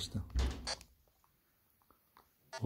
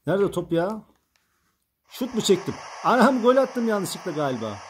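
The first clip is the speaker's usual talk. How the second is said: higher, louder surprised exclaim; startled by the sudden goal; 8 semitones higher, 4 dB louder than usual. Real, not acted. Confused then surprised goal.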